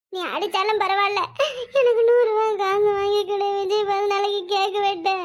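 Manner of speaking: sing-song mock wail, long vowels; fake crying. Son defying threat of beating, fake wailing for money. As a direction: bawl stubbornly with pretend tears. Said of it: "அழுவுற மாதிரி நடிச்சாதே எங்க அம்மா நம்புவாவ".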